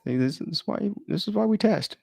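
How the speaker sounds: ordinary talk, nothing marked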